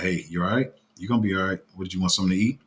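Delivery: mellow, even-pitched check-in; monotone. Retold or imitated. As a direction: keep it level and unfussed, like a calm mom. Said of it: "she just talks pretty monotone".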